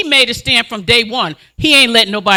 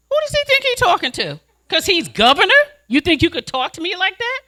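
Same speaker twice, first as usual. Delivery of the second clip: indignant; 8 semitones higher than usual. Real, not acted. Indignant at governor's brush-off.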